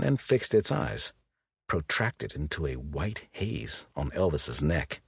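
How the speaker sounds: ordinary delivery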